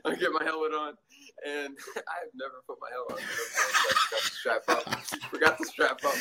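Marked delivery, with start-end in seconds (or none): 3.2-5.0 slow, breathy laughing through the words; laughing at his own blunder. Real, not acted. 5.3-6.1 real laughter, louder and higher; louder laughing. Real, not acted. Repeats it laughing harder.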